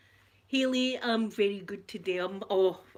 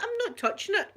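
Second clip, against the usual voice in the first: retold protest; 13 semitones higher, 3 dB louder than usual. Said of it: "I'm going".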